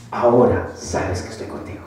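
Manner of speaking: very low, hushed divine voice; voz de Dios. Retold or imitated. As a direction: say it deep and softly, as quiet assurance. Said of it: "que Dios me dice". (voz divina, muy grave y suave)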